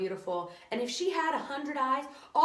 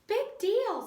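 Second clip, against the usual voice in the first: 1 pigtail voice; 11 semitones higher, 4 dB louder than usual. A narrator's reading. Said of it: "said the pigtail".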